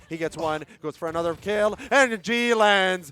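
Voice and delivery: rising hype to a roar; esports caster. Real, not acted. Clutch play called live.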